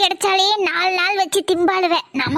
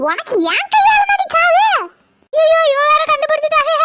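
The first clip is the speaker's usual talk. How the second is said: angry scolding; 7 semitones higher than usual. Acted. Woman catches the fake possession and scolds her, high and furious. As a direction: tear into her for faking.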